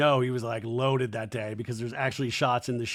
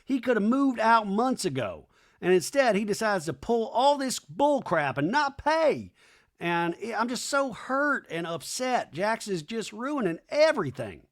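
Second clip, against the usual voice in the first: imitating Brittany; 8 semitones higher than usual. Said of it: "Brittany in a talking head goes".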